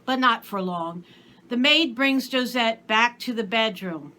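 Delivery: usual speaking voice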